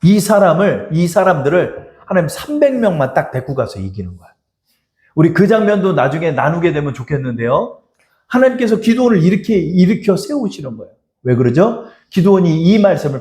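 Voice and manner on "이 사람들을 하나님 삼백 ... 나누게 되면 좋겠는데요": ordinary delivery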